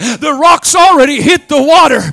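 whooping preacher's crescendo; preaching at full force. Acted. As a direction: shout the victory to the congregation.

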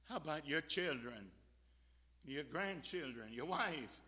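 normal talking voice

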